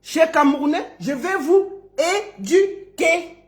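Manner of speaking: spaced, careful "éduquer"; class repetition. Shown as a demonstration. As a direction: lead the class through "éduquer" by syllable. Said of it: "on répète".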